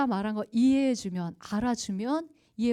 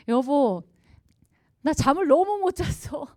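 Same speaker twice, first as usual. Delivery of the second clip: complaining wife; 5 semitones higher, 6 dB louder than usual. Retold or imitated. Wife complaining to husband, acted.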